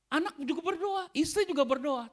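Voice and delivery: rising fervour. Real, not acted. Preacher's voice rises on family praying.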